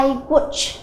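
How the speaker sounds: teacher softly showing no s after "watch". Shown as a demonstration. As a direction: quietly model "I watch" with no added ending. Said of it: "cô sẽ đọc là".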